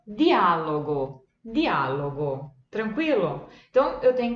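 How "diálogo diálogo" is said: diálogo slow, hard d, never jee; Italian di. Shown as a demonstration. Teacher slowly models diálogo. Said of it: "um outro exemplo".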